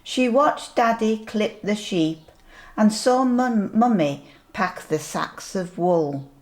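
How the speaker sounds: ordinary delivery, nothing marked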